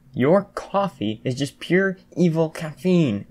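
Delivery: high, slow, emphatic exclamation; exclaiming. A narrator's reading. Jiro, bitter from losing, condemns the coffee, exclaiming. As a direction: stretch out the dramatic complaint. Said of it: "Jiro exclaimed".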